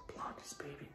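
soft teasing whisper. Acted. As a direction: whisper it like a secret. Whispers about blondies.

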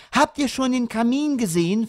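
high, excited question; delighted mother. A narrator's reading. Mother showing the children the fireplace, delighted. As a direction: pitch it up with delight.

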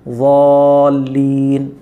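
ضالین drawn out very slowly and heavy; correct zuaaleen. Shown as a demonstration. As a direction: stretch ضالین with a thick ض. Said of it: "تو وہ غلط ہے". (ضالین بہت آہستہ اور موٹا)

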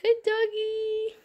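slow high cooing pet voice; cooing to dogs. Real, not acted. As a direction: coo softly at the dogs.

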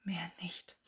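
soft, disbelieving echo; quiet scoff. A narrator's reading. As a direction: repeat it under your breath, unimpressed. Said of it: "Hermine schnaubte leise".

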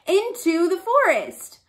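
ordinary delivery, nothing marked

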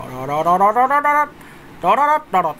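rattling tongue-roll "rororo" bursts; rapid rolling noise. Shown as a demonstration. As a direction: imitate a rapid rattle by mouth. 探す様子を口で音まね. (舌を転がす連続音)

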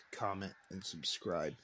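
ordinary talk

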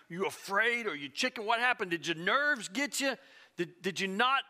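usual speaking voice

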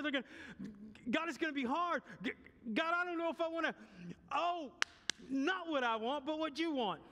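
high, anguished pleading to God; pleading prayer. Pretend. Jesus praying before the cross, rising anguish. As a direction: beg as Jesus in the garden.

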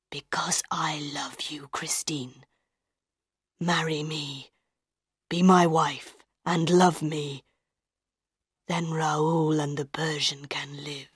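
low, hushed, intense proposal; captor declaring love. A narrator's reading. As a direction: press her softly in a low voice. Captor pleads his love.

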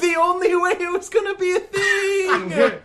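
very high, shouted shopkeeper voice; excited shopkeeper. Acted. Shopkeeper amazed to have the sack, high excited shout. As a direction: shout it at the top of your range, thrilled.

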